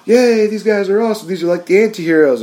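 high, gushing fan cheer; imitating cheering fans. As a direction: play a thrilled fan gushing. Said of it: "the fans were just like".

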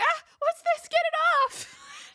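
very high, loud mock shriek; very high hammed-up panicked squeal. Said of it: "She's like".

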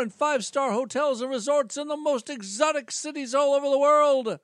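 high, upbeat pitchman voice; salesman voice. Acted. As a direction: pitch it like a cheesy presenter.

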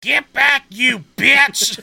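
high, loud yelled quote; retold yell. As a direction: yell it at the tiger. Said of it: "retold tiger scene yell".

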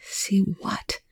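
hushed, uneasy question; sick with dread. A narrator's reading. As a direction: ask it with quiet dread. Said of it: "feeling sick with dread".

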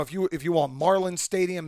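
ordinary talk

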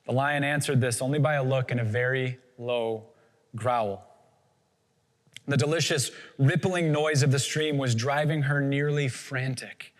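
normal talking voice

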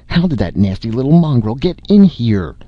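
high-pitched affronted fussing; high-voiced outraged mother; indignant scolding. A narrator's reading. Mother scolds about dog in bed.

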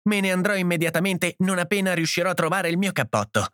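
high-pitched, agitated declaration; upset cry. A narrator's reading. Edith storming out for her coat, upset cry. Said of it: "gridò Edith".

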